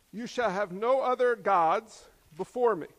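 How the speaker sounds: loud, emphatic commandment reading; forceful, measured Bible reading; scripture proclamation. Acted. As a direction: lay down the law from the pulpit. Reads commandments aloud.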